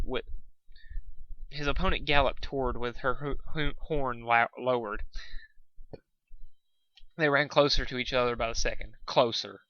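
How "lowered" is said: plain reading voice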